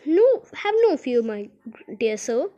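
usual speaking voice

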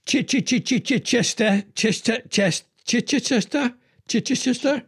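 "Chester" after repeated stuttered "Ch-" starts; stumbling pronunciation. Shown as a demonstration. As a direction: stammer on "Ch-", then try "Chester", over and over. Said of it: "I never know how to pronounce that".

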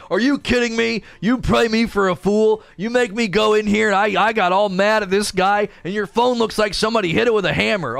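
high-pitched imitation of a father's tirade; mock angry dad. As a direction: rant like a dad tearing into his son. Imagined dad scolding his son.

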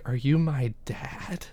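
soft, slow whispered question; hushed whisper. Retold or imitated. Leia asking Obi-Wan if he's her father, whispered slowly. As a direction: breathe it out, barely voiced.

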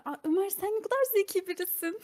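high, giggly swooning compliment; admiring girl voice. Pretend. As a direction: coo like a smitten girl. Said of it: "kız keşke şey yapsa".

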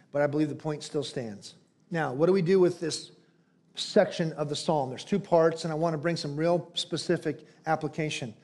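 normal talking voice

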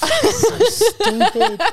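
squealing high through laughter; very slow, giggly and high; laughing. Real, not acted. Giggling at the teasing.

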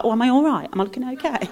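mock-worried vanity, fading to quiet; lightly voiced insecure woman; anxious woman. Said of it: "she'd be like".

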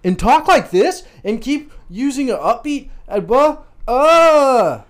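put-on high, loud upbeat kids' host; kids' show voice. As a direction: hype it up like a children's YouTuber. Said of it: "stupid as fuck so that you get all the children".

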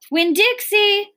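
high-pitched calling of a name; shouted call. A narrator's reading. Preacher searching for daughter's lost dog, shouting high. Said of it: "the preacher shouted".

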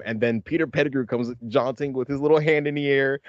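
usual speaking voice

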